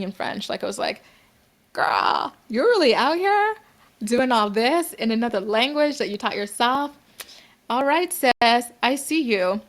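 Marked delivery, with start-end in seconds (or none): 1.7-9.6 sassy acted amazement, pitch leaping up; impressed self-hype. Praising herself for dating in French, mock-impressed. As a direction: hype yourself up like an impressed friend. Hyping herself up in a retold thought.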